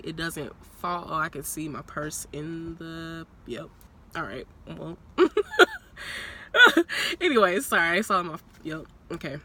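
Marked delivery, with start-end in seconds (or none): 5.2-7.2 high laugh. Real, not acted. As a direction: giggle up high. Laughs at her purse.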